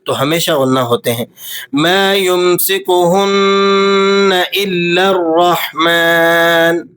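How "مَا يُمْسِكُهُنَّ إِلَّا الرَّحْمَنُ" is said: يُمْسِكُهُنَّ with a held nasal ن; nasal ghunna. Shown as a demonstration. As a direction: hum the نّ in يُمْسِكُهُنَّ. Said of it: "نون پر آپ نے غنہ ادا کرنا ہے". (يُمْسِكُهُنَّ میں نون پر غنہ)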